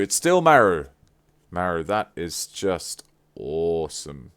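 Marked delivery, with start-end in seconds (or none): 0.0-0.9 high, loud and delighted; excited. Real, not acted. Gift opener still thrilled by the Maru artwork. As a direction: keep squealing with joy.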